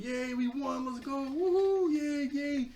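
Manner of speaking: high, loud mock cheer; kids' cheering. Kids celebrating their win over Mala, yelling. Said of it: "All the kids are, like, yelling".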